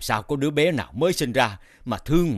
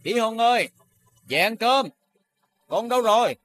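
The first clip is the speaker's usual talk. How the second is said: calling out; 9 semitones higher than usual. Acted. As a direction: call across the yard, much higher. Father calls hidden son.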